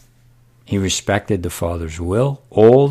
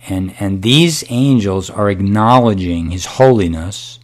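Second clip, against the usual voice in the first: usual speaking voice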